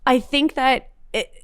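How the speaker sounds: usual speaking voice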